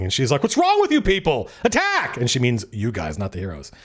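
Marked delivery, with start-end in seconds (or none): 0.4-2.2 high-pitched acted shout to rally fighters. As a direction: yell it as an exasperated order to attack. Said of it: "she starts shouting".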